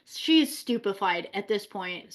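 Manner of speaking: usual speaking voice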